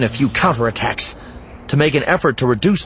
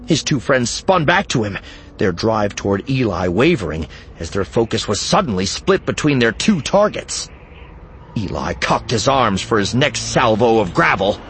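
usual speaking voice

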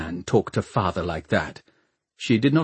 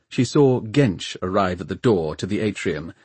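plain reading voice

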